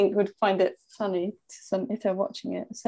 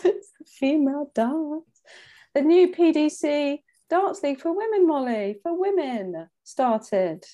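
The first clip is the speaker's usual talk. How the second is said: slow announcement; 5 semitones higher, slower than usual. Acted. Grand darts announcement, teasing.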